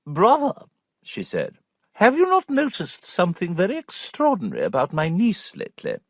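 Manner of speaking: the prim sister confiding to her brother; prim aunt. A narrator's reading. Aunt voiced higher.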